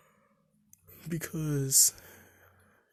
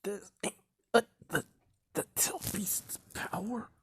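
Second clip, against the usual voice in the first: whispering; 8 semitones higher, 4 dB quieter than usual. Retold or imitated. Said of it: "Mizuki would be like".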